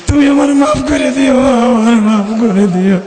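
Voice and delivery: acted dying son's lament; pleading farewell. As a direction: beg forgiveness in a tearful chant. Dying son begs mother's forgiveness.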